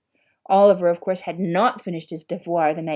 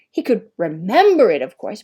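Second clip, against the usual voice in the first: ordinary delivery, nothing marked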